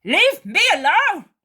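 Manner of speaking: high, loud rude snap. A narrator's reading. Judas rudely telling Mary to leave him. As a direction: shove the words at her. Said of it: "says Judas rudely".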